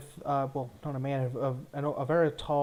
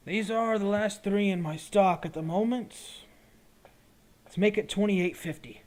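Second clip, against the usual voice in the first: lightly voiced, higher shopkeeper; potion seller haggling; 6 semitones higher, 3 dB louder than usual. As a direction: hold your price as the merchant.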